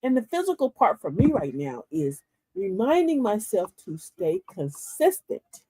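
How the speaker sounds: ordinary talk, nothing marked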